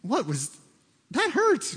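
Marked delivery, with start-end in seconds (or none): 1.1-1.7 mock pained yelp. Reacting to being thumped.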